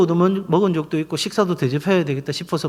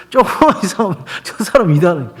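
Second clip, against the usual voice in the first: amused repeat; faster than usual. Retold or imitated. Pastor imitating his suspicious wife for laughs, amused repeat. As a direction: quote it through leftover laughter.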